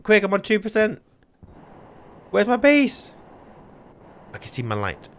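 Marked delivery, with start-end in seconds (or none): 0.0-1.0 high, rapid-fire panicked repeats; frantic urgency. Real, not acted. Spots water, almost out, frantic. 2.3-3.1 very high, loud alarm; panicked cry. Real, not acted. Panicking, lost, nearly dead.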